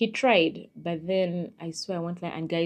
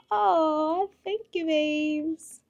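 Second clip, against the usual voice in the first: high, cooing delight; gushing thanks; 11 semitones higher, much slower than usual. Real, not acted. Thanking a follower for a compliment, cooing. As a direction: coo it out high and slow. Gushes thanks at a flattering comment.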